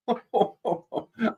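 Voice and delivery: high string of short chuckles; jolly chuckle. Real, not acted. Santa praising a viewer's lovely laugh, high jolly chuckle.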